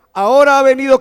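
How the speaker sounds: loud, high proclamation of scripture; great voice. Retold or imitated. Preacher voicing the great voice in heaven, loud proclamation. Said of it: "esa gran voz decía".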